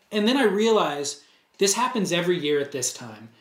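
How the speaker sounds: ordinary talk, nothing marked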